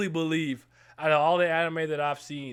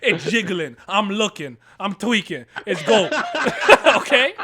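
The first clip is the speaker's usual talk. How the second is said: laughing; 7 dB louder than usual. Real, not acted. Joking about character.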